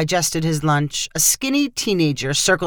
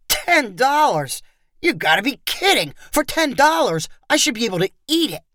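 incredulous tourist haggling, voice pitched up; loud scoffing; 6 semitones higher than usual. A narrator's reading. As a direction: scoff in amused outrage at the price. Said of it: "Tony replied with a loud laugh".

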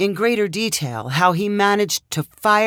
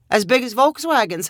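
skeptical, higher, amused echo; amused disbelief; 6 semitones higher than usual. A narrator's reading. Bryn echoes, held-back laugh.

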